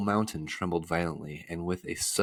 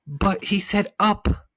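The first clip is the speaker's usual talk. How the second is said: high, loud, slow whine; bitter complaint; 14 semitones higher, 7 dB louder, slower than usual. A narrator's reading. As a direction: complain bitterly, dragging the words out. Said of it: "Milo complained bitterly".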